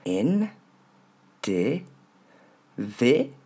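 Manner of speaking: N, D, V as "in", "dé", "VÉ", with gaps; syllable by syllable. Shown as a demonstration. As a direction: isolate each sound for learners. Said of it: "il porte l'accent tonique".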